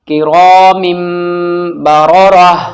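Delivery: "किरामिम" with a long hummed final m; flowing recitation. Shown as a demonstration. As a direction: hold the m, then recite "बररह". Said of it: "रवानगी से पढ़ते हैं". ("किरामिम" पर गुन्ना खींचकर)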